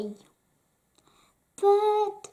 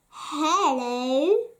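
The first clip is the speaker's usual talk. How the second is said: menacing thief; 6 semitones higher, 6 dB louder, slower than usual. Acted. Thieves greeting menacingly.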